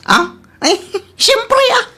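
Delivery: high laughing brag; proud giggle. Acted. Bragging about outfit.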